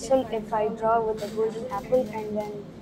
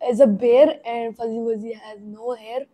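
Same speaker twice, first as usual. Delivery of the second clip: ordinary talk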